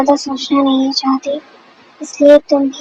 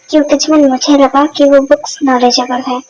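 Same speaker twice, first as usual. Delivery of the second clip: ordinary delivery, nothing marked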